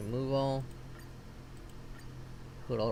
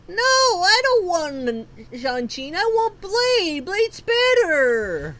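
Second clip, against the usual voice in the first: angry protest; 9 semitones higher, 4 dB louder, slower than usual. Real, not acted. Protesting the wrong character.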